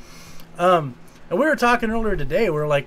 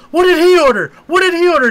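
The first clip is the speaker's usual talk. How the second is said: high, loud panicked question; mock-panicked yell repeated; alarmed shout; 11 semitones higher, 9 dB louder, faster than usual. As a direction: yell it like the heroes fear the worst. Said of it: "they go".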